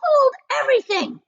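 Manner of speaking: sharp, high cry of stop; sudden stop. Acted. Aunt Spiker halting James, a sharp high cry.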